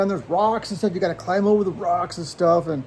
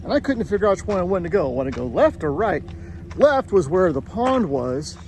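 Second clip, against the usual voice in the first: usual speaking voice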